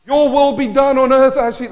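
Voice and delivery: quoted preaching. Retold or imitated. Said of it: "I've heard people preach this".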